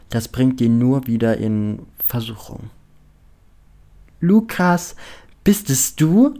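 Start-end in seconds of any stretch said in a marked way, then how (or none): 4.2-6.4 high, excited call across a square; enthusiastic shout. A narrator's reading. Penelope spotting Lukas across the square, enthusiastic shout. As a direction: call out to him, delighted and rising. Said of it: "schreibt Penelope enthusiastisch über den Platz".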